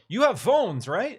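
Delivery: high, loud incredulous mock question; a sneering send-up of the phone announcement; mocking Diablo Immortal quote. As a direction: parody the dev line with disbelief. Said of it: "like the whole Diablo Immortals narrative".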